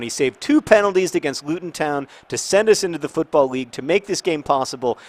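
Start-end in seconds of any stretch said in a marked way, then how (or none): none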